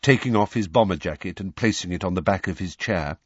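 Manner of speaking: plain reading voice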